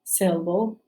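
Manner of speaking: ordinary talk, nothing marked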